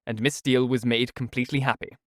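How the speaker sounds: usual speaking voice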